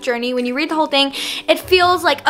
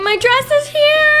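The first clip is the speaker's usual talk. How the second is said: excited text; 13 semitones higher, 4 dB louder, slower than usual. Retold or imitated. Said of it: "My mom just texted me".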